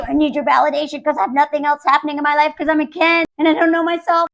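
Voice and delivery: rushed, babbling confession; mock neediness. Needy Ken seeking validation, rushed mock pleading. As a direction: spill it all out fast, mock-desperate.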